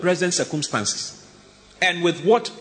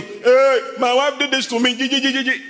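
high, loud aggrieved ranting; mock furious husband; complaining husband; 10 semitones higher, 4 dB louder than usual. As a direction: sputter like an outraged husband. Husband voiced complaining about wife.

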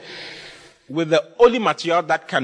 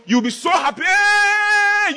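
high hammed-up celebration rising to a shriek; mock overjoyed; slower than usual. Preacher imagining a sudden millionaire, hammed-up ecstatic shouting. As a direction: whoop it up, ending in a long high yell.

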